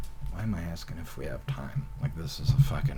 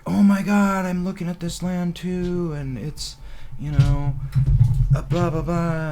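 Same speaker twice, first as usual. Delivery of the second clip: put-on higher, louder excited girl voice; girl's gushing; 11 semitones higher, 7 dB louder than usual. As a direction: gush about the land. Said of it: "she's like".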